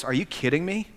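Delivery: softly voiced, incredulous disgust. Older brother questioning his dad, quietly disgusted. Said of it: "You can almost read the disgust in his tone of voice".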